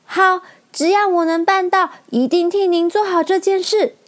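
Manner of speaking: 音调很高的小女孩嗓音; 小女孩一口答应大王; 爽快答应的小女孩. A narrator's reading. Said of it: "一口就答应了". (爽快地答应)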